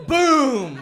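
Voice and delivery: sudden high loud shout of boom; mock influencer yell. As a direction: bark it like a cheesy influencer.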